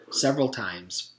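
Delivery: usual speaking voice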